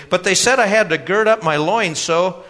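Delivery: put-on high, loud eager young man; earnest young customer. A narrator's reading.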